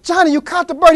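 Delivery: high, thrilled burst; breathless high excitement; excited kid. Acted. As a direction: squeal it like a kid who can't believe it. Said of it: "Robert is so excited".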